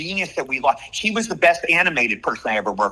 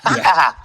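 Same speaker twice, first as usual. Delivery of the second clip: Mandark laugh; 4 dB louder than usual. Shown as a demonstration. Showing the famous cartoon villain laugh, maniacal cackle. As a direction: burst into a chopped mad-scientist laugh. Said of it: "Will you do the Mandark laugh?".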